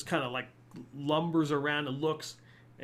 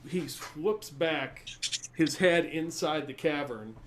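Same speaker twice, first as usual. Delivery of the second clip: ordinary talk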